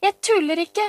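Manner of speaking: put-on high, loud little-girl cry; indignant child's shout. A narrator's reading. Pia-Pia protests to her doubting brother, indignant child's shout. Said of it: "ropte Pia-Pia".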